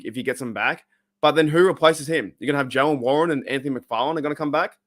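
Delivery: ordinary delivery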